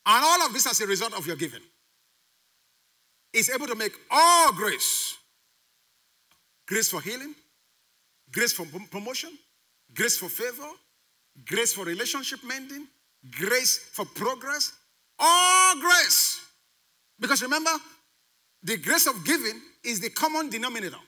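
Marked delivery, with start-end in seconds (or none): 4.1-5.2 "all" stretched and hit loud; stress on all. Real, not acted. Preacher expounding the verse on giving, long stress on "all". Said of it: "Look at the emphasis on all". 15.2-16.4 "all" high, loud and drawn out; stress on all. Real, not acted. Preacher capping list of graces, high loud stress on "all". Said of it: "Look at the emphasis on all".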